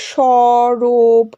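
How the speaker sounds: "সরব" slowly, each syllable held long; broken into syllables. Shown as a demonstration. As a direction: draw out the pieces of "সরব". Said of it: "কিভাবে আমরা উচ্চারণ করছি". ("সরব" দল ভেঙে টেনে টেনে)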